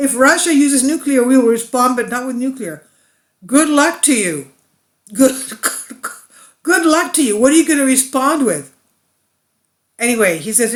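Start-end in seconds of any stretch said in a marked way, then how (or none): 0.1-2.8 sarcastic, higher and louder retelling of the quote; mocking paraphrase. Mocking retelling of Borrell's statement. 3.5-8.7 higher, louder scoffing with laughs; scoffing at Borrell's threat, high and loud; sarcastic good luck. Real, not acted. Sarcastic reaction to the EU stance.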